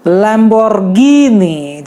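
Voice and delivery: "Lamborghini" very slowly, long ee; stretched Lamborghini. Shown as a demonstration. Teacher repeating the Italian stretch=104. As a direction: draw out "Lamborghini". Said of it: "जैसे कि मैंने बोला".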